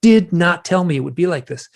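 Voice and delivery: high, indignant accusation, short of a scream; retold screaming. Man telling the beings they misled him, retold angry scream. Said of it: "I was, like, screaming at them".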